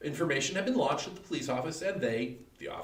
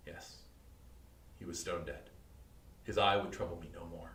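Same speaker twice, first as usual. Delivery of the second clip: much softer and lower, almost to himself; hushed, chilling calm; 9 semitones lower, 10 dB quieter than usual. Acted. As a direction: savour the stillness of the corpse. Gloating over the dead man.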